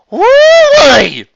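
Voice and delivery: very high, loud whoop; crowd cheer. Acted. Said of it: "each and every pony let out".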